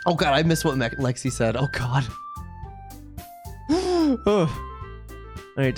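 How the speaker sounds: usual speaking voice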